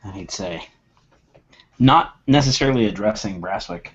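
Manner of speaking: usual speaking voice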